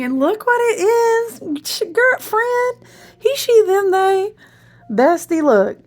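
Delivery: excited. Real, not acted. Showing viewers the card she pulled, high and thrilled. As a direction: call out to everyone at once in delight.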